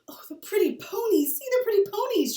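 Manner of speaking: put-on high, loud, delighted child's cry; excited cry. Little Laura cries out to Pa about the ponies, thrilled. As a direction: squeal it out like a thrilled little girl. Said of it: "she cried, clapping her hands".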